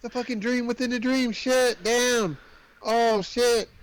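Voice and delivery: high-pitched, exasperated retold shock; mock dismay. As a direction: exclaim like the twist just hit you. Acting out shock at dream twist.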